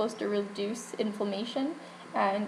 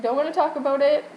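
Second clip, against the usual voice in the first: higher, louder mock-crying blurt; retold tearful protest; 5 semitones higher, 6 dB louder, faster than usual. Patient fending off the kind pharmacist, mock tearful. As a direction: rush it out like a comic weepy plea. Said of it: "So I just started crying".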